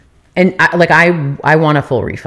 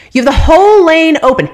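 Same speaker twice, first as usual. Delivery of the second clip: indignant outburst; 9 semitones higher, 4 dB louder than usual. Real, not acted. Ranting about the yelling valet.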